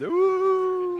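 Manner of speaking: very high, delighted squeal; delighted ooh. Real, not acted. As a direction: coo over something cute. Delight at cute ghost treats.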